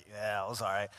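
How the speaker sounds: put-on low, quiet, offhand little boy; imitating a stoic kid, voice dropped; stoic, unbothered. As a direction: shrug it off, not caring. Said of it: "he's just stoic. He don't care".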